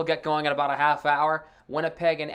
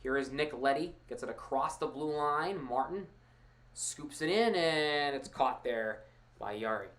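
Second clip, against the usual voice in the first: play-by-play. Acted. Live hockey play-by-play.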